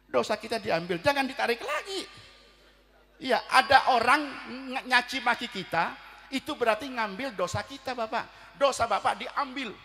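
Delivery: loud, high sermon exhortation; preacher urging the congregation not to answer back; forceful preaching. Acted. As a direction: press the lesson on the listeners.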